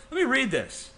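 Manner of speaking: ordinary talk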